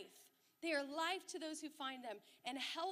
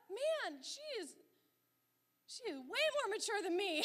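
higher pitch, laughing through the words; laughing amazement; 6 semitones higher, 5 dB louder than usual. Retold or imitated. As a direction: marvel at her, half laughing. Mom laughing at herself.

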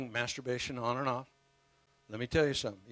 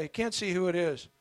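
usual speaking voice

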